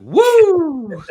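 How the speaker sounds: loud, high woo of delight; whooping cheer. Real, not acted. Host whooping for the guest's answer, loud cheer. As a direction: let out a big happy whoop.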